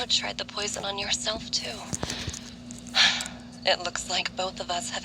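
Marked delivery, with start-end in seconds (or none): 1.8-3.4 weary sigh. Acted. Sad sigh about their master.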